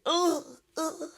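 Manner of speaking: high imitated grunts of a man recoiling; mock grunts. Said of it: "he's like".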